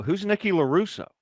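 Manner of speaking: high-pitched puzzled question; imitating innocent confusion; retold bewilderment. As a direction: act out being baffled by a name.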